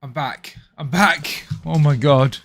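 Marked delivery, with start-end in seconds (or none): 0.8-1.4 louder, lifted "I'm back"; triumphant announcement. Real, not acted. Streamer returns after camera fix. 1.6-2.4 loud "oh my God" of relief; loud relieved exclamation. Real, not acted. Relief after technical nightmare.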